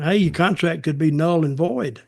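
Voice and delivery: normal talking voice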